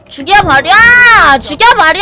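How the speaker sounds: loud battle yell. Real, not acted. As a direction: shout at the enemy. Battle yelling at a monster.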